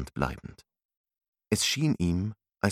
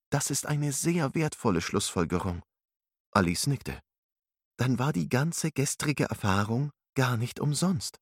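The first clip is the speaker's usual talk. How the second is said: put-on young woman voice; 7 semitones higher than usual. Alice's voice in narration.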